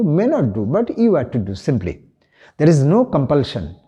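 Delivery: ordinary delivery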